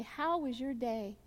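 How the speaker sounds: slow, absent-minded how was your day; distracted asking. Shown as a demonstration. To someone you're not really looking at, absently. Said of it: "you're not really looking them in the eye".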